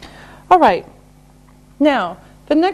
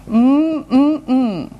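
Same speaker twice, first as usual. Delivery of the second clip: appreciative mm sounds, mouth closed; yummy humming. Shown as a demonstration. Teacher savouring imaginary soup, yummy hum. Acts out tasting delicious soup.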